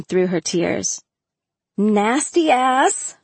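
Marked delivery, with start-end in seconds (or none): 1.8-3.2 slow, high, drawn-out tease; amused mock disgust; laughing tease. A narrator's reading. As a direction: playfully scold a friend, dragging it out. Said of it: "I laughed".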